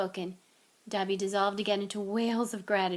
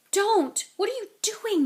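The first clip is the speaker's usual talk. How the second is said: hissing; 12 semitones higher, 6 dB louder than usual. A narrator's reading. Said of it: "Harry hissed".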